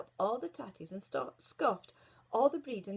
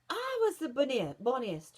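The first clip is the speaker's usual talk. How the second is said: louder, higher queen's shout; 4 semitones higher, 6 dB louder than usual. Acted. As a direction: shout it in wounded vanity. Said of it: "shouted the Queen".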